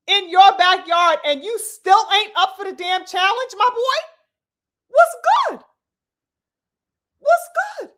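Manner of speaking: high, loud taunt; taunting challenge. Real, not acted.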